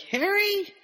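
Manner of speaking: high, rising questioning call; worried calling out. Retold or imitated. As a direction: call a name up high, as if checking on someone. Someone calling to injured Harry, retold.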